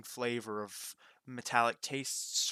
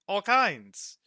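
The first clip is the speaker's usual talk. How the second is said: higher and louder, proud; excited boast; 8 semitones higher, 9 dB louder than usual. Acted. Roka boasts of the stock, excited and high. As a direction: sell it with delight.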